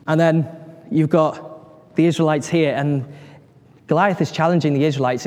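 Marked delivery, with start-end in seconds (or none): none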